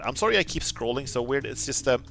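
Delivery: ordinary talk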